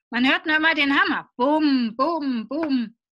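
loud quick bum bum like a hammer; hammer bangs. Acted. Reading to kids, imitating the hammer with loud bums. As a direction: bang out the hammer noise.

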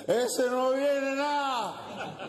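put-on high, drawn-out drunken heckle; heckling drunk. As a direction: call it slowly from the back of the crowd. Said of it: "Y vuelve el borracho atrás y dice".

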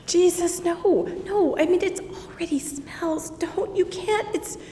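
high, breathless whispered pleading; gasping protest. Acted. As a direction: beg him not to open the tomb. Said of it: "And I gasped".

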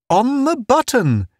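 high-pitched gleeful crow. A narrator's reading. Town pleased Pace guessed the time, high glee. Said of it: "he said gleefully".

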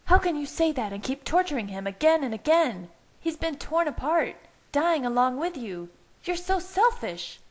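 high-pitched, outraged accusation; shrill tirade. A narrator's reading. Leah accuses Bella of torturing Jake, shrieking.

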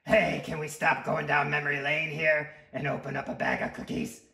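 loud, pushy character voice; demanding, stretching out the final craving; impatient sweet tooth. Acted. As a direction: bellow like a greedy, impatient little monster. Story figure: impatient tooth.

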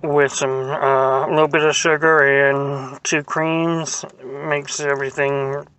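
talking while chewing food; muffled by napkin at mouth; mouth full, chewing. Real, not acted. Seen 3: Bite seen at -3, lips closed chewing, then napkin wiping mouth.

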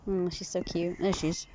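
normal talking voice

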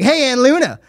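put-on loud, high, laughing drunk taunt; drunk outburst. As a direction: blurt it out, loud and tipsy. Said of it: "and she's like".

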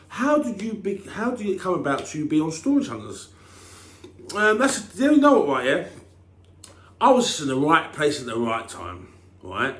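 normal talking voice

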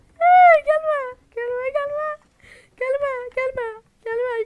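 high, loud, crying pleas; terrified wailing. Real, not acted. To the approaching monster, a high crying wail. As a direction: beg it to stay away, near tears.

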